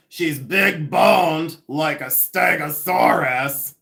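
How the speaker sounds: milk each word like a stand-up comic; imitating Judy Tenuta. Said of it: "and then she would say".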